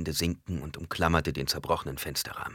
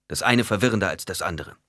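usual speaking voice

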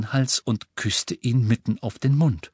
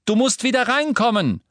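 high, raised call after someone; calling after her; 14 semitones higher, 4 dB louder than usual. A narrator's reading. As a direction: shout it up the stairs. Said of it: "rief er ihr nach".

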